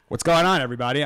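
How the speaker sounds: loud host greeting; loud show intro. Acted. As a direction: greet the audience loudly.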